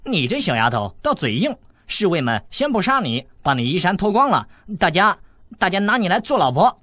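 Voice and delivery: rising threat meant to scare her; crude taunting. Acted. Boy crudely taunts the stubborn girl, loud and rising.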